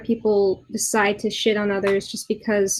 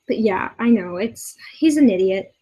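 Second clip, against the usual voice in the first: ordinary delivery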